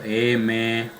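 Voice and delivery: M drawn out into a low, resonant hum; nasal phoneme demo. Shown as a demonstration. Teacher showing students the nasal phonemes, M held with nasal resonance. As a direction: say M slowly, letting it resonate in the nose. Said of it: "vamos a pronunciar los famosos fonemas nasales".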